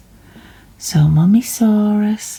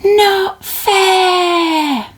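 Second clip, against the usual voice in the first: crying protest; 13 semitones higher, 5 dB louder than usual. Acted. Child protests bedtime refusal.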